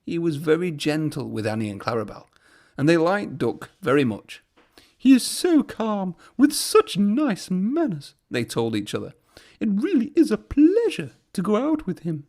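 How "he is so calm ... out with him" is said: high admiring coach voices; admiring coaches. A narrator's reading. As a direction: gush about him fondly.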